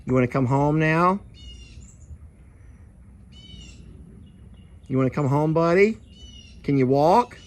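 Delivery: sweet-talking an animal to follow him; coaxing the bull. Real, not acted.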